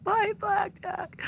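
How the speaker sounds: high slow pet-talk goodbye; sing-song goodbye. Acted. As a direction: croon it to the cat.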